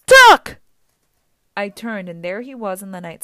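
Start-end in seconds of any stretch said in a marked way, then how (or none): 0.0-0.6 very loud, high-pitched shout; yelled warning. A narrator's reading. Grover dive-bombs toward Percy, yelling a warning. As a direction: yell it like an emergency. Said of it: "Grover yelled".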